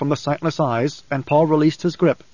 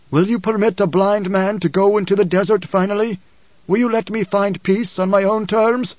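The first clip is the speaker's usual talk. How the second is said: blind father pleading in a higher voice; high-pitched, strained plea to his son; Paul, pleading; 6 semitones higher than usual. A narrator's reading. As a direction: voice a weary father begging for release.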